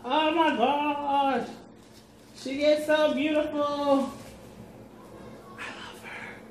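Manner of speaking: slow, soft adoring gush; awed gushing. Real, not acted. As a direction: swoon over it. Gushing over the coat.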